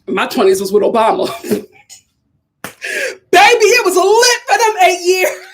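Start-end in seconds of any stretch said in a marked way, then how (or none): 3.3-5.5 loud, much higher, slow, laughing hoot; laughing shout. Real, not acted. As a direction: crow it out while cracking up. Laughing nostalgia about Obama years.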